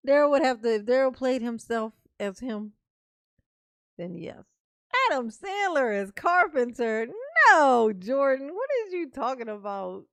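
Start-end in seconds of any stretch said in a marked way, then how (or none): 0.1-2.7 loud insisting. Real, not acted. Insisting loudly on casting Daryl. 5.1-10.0 loud protest. Real, not acted. Protesting a chat suggestion.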